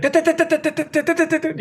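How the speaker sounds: high rapid mocking tuts; mock frantic calling. Mocking how people overdo fast duck call cadences, high and hurried. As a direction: parody the overblown fast cadence. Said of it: "People think".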